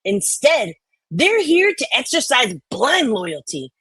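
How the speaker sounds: normal talking voice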